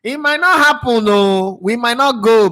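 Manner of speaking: high, slow, drawn-out warning; ominous prophecy. Retold or imitated. Pundit quotes his own old prediction about the group, high and slow. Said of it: "I said".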